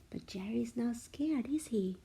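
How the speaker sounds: ordinary delivery, nothing marked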